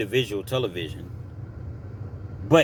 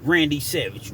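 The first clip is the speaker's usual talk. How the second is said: ordinary delivery, nothing marked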